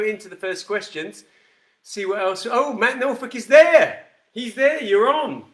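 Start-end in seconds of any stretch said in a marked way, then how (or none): 2.5-5.5 loud, high, delighted to spot a viewer; real excited welcome; excited greeting. Real, not acted. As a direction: call out happily to a viewer who just joined. Spots a viewer joining live.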